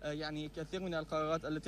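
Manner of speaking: signal dropping out on a call; reentry blackout. Real, not acted. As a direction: voice fading through a broken line. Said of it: "أن هناك خلل في الاتصال".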